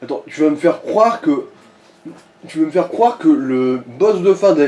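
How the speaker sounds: ordinary talk, nothing marked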